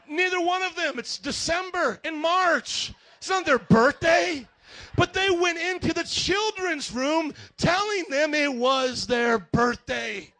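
loud, slow, emphatic preacher pounding each word; full-force preaching. Acted. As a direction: preach it out to the whole room, slowly.

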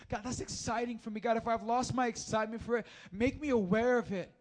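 fervent preacher's plea; impassioned prayer. Acted. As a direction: cry out to God with full conviction. Impassioned first-person plea to God.

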